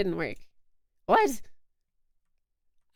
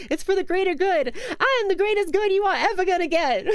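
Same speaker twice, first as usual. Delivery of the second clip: higher, louder movie boast; hammy villain-hero bravado; mock superhero boast; 8 semitones higher, 4 dB louder than usual. Streamer quoting the super suit scene, hammed-up. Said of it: "That's what it was".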